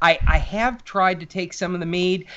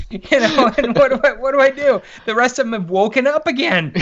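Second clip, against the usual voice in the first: laughing; 9 semitones higher than usual. Real, not acted. Still laughing at own story.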